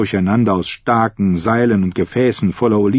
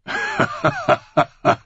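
hohes, abgesetztes Hohngelächter; schallendes Lachen. A narrator's reading. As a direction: lach hoch und schallend auf. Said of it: "Dunkelstern lachte schallend". (schallendes Gelächter)

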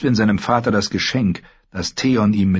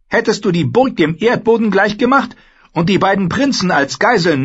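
much higher, louder sister lecturing her brother; Asha, cool and scornful; 13 semitones higher, 4 dB louder than usual. Acted. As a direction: talk down to him, sharp and sure. Asha mocks him.

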